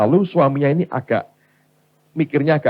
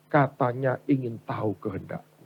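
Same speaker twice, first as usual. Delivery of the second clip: soft, restrained divine reply; God answering quietly; 3 semitones lower, 7 dB quieter than usual. Acted. God replying to the wife's protest, quiet answer.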